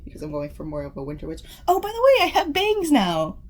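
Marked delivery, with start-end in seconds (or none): 1.7-3.4 high, loud, bursting with news; excited outburst. Real, not acted. Telling viewers about her new bangs, excited outburst. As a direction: blurt it out, thrilled. Sudden excited hair announcement.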